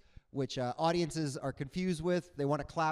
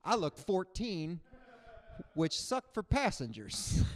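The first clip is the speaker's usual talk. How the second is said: ordinary talk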